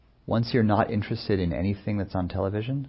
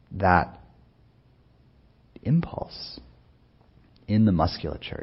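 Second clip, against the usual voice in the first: usual speaking voice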